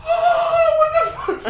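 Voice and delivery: high astonished laugh-squeal; shocked disbelief. Retold or imitated. Shocked at himself after call.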